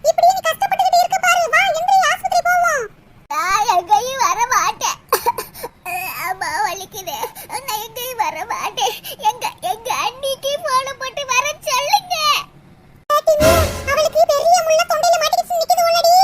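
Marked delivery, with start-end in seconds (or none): 3.3-12.1 low, slow, whimpering plea from a sore throat; pained girl with bone in throat. Acted. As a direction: beg weakly, voice low and hurting. Same girl, refusing, crying. (தொண்டை வலியில் தாழ்ந்த குரலில் கெஞ்சும் பெண்)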